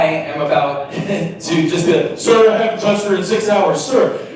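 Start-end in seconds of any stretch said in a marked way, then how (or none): 2.2-4.2 high, loud nervous plea with a laugh; mock panicked plea. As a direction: blurt it out like a caught teenager. Caught by her dad, panicked plea.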